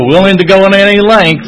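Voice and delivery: high, loud imitation of the old-timer; loud challenge. Old-timer challenging a reluctant newcomer, loud and insistent. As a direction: throw the slogan out forcefully.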